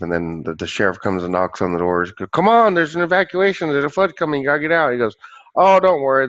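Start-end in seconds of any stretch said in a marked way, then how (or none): 2.3-4.9 loud, raised urgent warning; urgent sheriff. Acted. Sheriff urging evacuation in a joke. 5.6-6.3 loud, higher carefree brush-off; breezy dismissal. Acted. Man waves off the sheriff.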